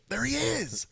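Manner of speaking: high excited shout of recognition; excited call. Retold or imitated. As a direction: call it out as you spot him. Spotting the runner, calling out.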